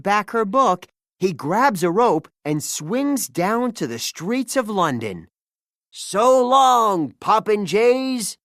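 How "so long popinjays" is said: high, loud taunt, slow on Popinjays; gloating pirate yell. Acted. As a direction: jeer as you swing away. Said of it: "he yells".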